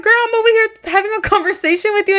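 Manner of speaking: high, quick retold complaint; mock an exasperated friend, fast and high; lightly acted friend's scolding; imitating exasperated friend. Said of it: "she's like".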